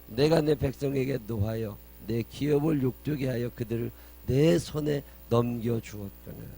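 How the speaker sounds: loud declaimed verse, slowing down; scripture proclamation. A narrator's reading. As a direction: proclaim it weightily, drawing out the last words. (큰 소리로 힘주어 끝을 늘이는 성경 봉독)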